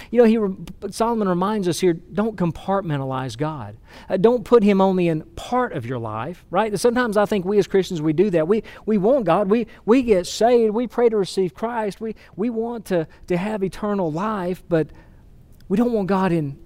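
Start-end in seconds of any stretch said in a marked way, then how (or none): none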